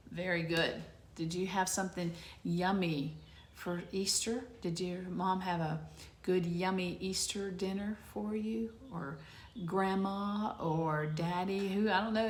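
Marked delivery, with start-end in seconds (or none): none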